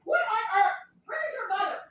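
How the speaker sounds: calling out. Real, not acted. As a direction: call from another room. Calling to child off-mic.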